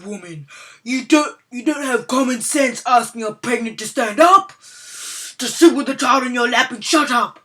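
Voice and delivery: lightly voiced badass old man; gruff old man scolding. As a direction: put her in her place, fed up. Old man scolds entitled mom.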